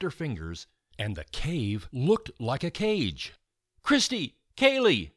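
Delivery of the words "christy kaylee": high, loud calls; girl shouting her friends' names. A narrator's reading. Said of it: "Julia shouted".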